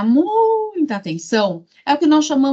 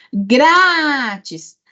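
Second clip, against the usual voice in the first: GRÁÁÁtis, GRÁ raised and held; calling the word; 3 semitones higher than usual. Shown as a demonstration. Teacher showing the tonic syllable, calling the word. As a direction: call out Grátis, stretching GRÁ.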